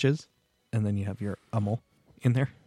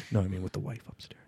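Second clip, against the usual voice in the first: whispering; 4 dB quieter than usual. Real, not acted. Wife upstairs, keeping voice down.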